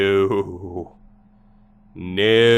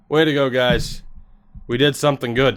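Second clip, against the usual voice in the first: normal talking voice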